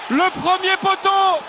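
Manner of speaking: high, loud, breathless call; excited commentary. Real, not acted. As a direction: keep riding the goal high. Excited call after goal. (commentaire surexcité)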